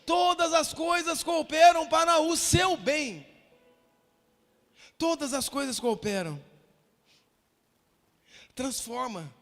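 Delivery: forceful exhortation from the pulpit; fervent preaching. Real, not acted. Pastor assuring tonight's visitors that God sees them, fervent preaching. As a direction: press each promise on the listeners.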